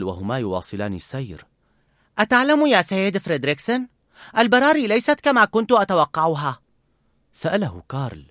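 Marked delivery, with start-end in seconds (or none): 2.2-6.6 put-on high, louder pleading boy; a worried boy begging; young boy voice. A narrator's reading. Boy pleads with old man to help bird.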